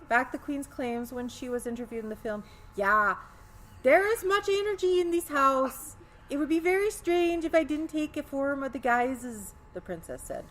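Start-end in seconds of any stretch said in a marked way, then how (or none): 2.7-9.5 put-on high, loud Swedish accent. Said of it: "should I do the Swedish accent?".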